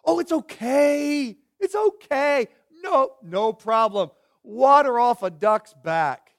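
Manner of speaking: put-on, too-easy reassurance; mock-breezy forgiveness. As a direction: brush it off with exaggerated ease. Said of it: "notice what he doesn't say".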